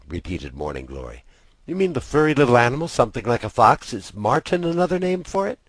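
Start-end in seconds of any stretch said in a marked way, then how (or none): 1.7-5.6 a hint of a curious girl, higher, quick at first; lightly voiced girl. Sister asking brother about the fisher, lightly voiced curious girl. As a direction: inquisitive little sister, brisk.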